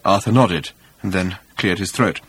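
ordinary delivery

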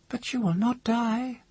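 very high, thin woman's voice; awed woman. A narrator's reading. Woman protesting the mage's mortality, high and awed.

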